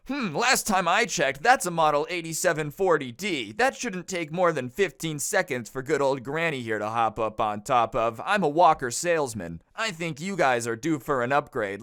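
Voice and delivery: mock the Karen as a pushy walker salesman; condescending expert parody; mock know-it-all.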